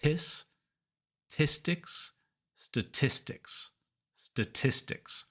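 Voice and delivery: Tis, tistics, statistics, slowly built with pauses; careful model of statistics with puffed T; syllable build-up drill. Shown as a demonstration. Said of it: "build up to the whole word".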